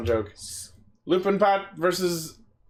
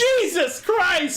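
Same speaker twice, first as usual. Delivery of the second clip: shocked exclamation; 11 semitones higher, much slower than usual. Real, not acted. Shocked by view count.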